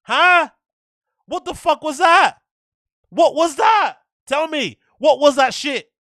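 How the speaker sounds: loud, high, incredulous demands; outraged shouting. Real, not acted. Fan ranting at a terrible fight, outraged shout. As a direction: yell it like you can't believe what you saw.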